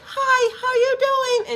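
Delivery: very high, squeaky mock greeting; tiny high voice. Big strong person greeting someone, in a comically high voice. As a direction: pitch it far up, imitating a silly little voice. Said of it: "they got a voice like".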